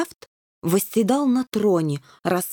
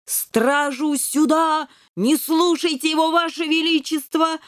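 general wheezing orders; 7 semitones higher than usual. A narrator's reading. General wheezes for guards after hitting the wall. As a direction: croak it out from the floor. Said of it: "хрипел генерал Грам".

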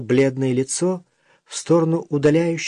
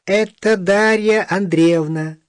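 put-on odd little old man voice; 7 semitones higher, 4 dB louder, slower than usual. Little old man defends Dasha.

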